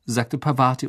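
ordinary delivery

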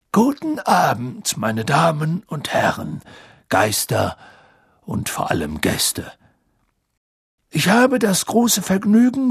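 stately orator greeting honoured guests; headmaster's welcome speech. Acted. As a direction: proclaim it grandly, drawing out the words. Dumbledore welcomes guests in the hall.

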